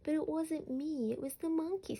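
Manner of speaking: put-on high, innocent child voice protesting; protesting little boy. A narrator's reading. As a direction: squeak out the excuse like a small boy caught out. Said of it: "said Alfie".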